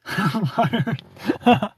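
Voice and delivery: short burst of real laughter; amused, spontaneous chuckling; laughing. Real, not acted. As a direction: laugh out loud at a comment. Laughs at a viewer's comment.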